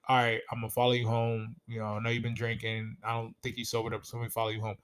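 low, soft caring voice; calm caring. Retold or imitated. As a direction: speak gently to her. Husband speaks calmly to wife.

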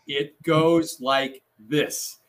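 very slow, measured lead-in; anchor's script read. Retold or imitated. Anchor introducing an Elvis song from the script, slow newsreading. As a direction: draw out each word of the introduction. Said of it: "read all the way to the point where she goes".

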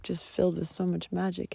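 dreamy whisper. Real, not acted. Telling viewers of conscious self, whispering. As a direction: whisper the magic. Speaking of magic, hushed.

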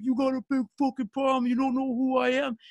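high-pitched blustering tough-guy imitation; mock tough guy. Opponent squaring up to the storyteller, mock tough. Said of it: "he's acting all tough".

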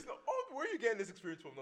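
high laughing disbelief. Real, not acted. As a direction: laugh out the question. Laughing at cousin's story.

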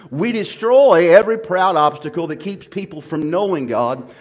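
loud, high-pitched sermon delivery; full-force preaching. Real, not acted. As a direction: preach it out loud, leaning hard on key words.

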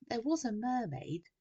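high, tentative admission; hesitant. A narrator's reading. Sam to the sceptical journalist, hesitantly. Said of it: "said Sam hesitantly".

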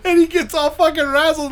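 amused retelling; laughing. Real, not acted. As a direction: talk through the giggles. Laughing while telling.

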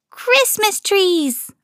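very high, slow, joyful troll cry; excited trolls shouting. A narrator's reading. Trolls thrilled about their trees, high and slow. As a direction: cheer it like happy trolls. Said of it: "they shouted".

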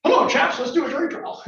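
high-pitched mock toff, hammed up; feather-in-hat gent. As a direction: lampoon a quaint old-fashioned gentleman, voice up high. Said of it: "that guy with a feather in my hat and be like".

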